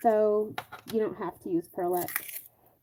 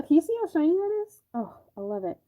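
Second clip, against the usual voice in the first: normal talking voice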